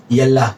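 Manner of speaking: यल्ला with a held double l; यल्ला as a teacher pronounces it; Arabic word demo. Shown as a demonstration. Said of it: "चलो का मतलब है".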